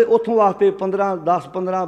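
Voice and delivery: normal talking voice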